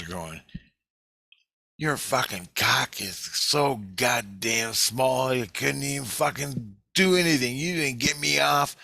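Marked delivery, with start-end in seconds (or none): 1.8-8.8 mock yell, drawn out and climbing higher; building half-yelled taunt; retold yelling. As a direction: imitate girls hurling slow, high-pitched insults. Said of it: "they're yelling at me".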